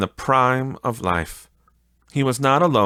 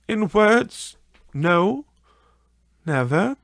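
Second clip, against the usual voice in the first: put-on higher, a gently grieving young woman; sorrowful young woman; 12 semitones higher, 5 dB louder than usual. A fiancée releasing Scrooge, higher and grieving. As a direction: let her sorrow show, slow at the end.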